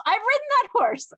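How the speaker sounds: loud, high-pitched imitation of his excitement; retold excited exclamation. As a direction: blurt it out as his astonished boast. Retold excited claim about horse.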